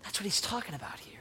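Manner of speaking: a hushed aside after the build; sudden whisper. Real, not acted. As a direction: pull the room in close with a hush. Preacher drops to whisper.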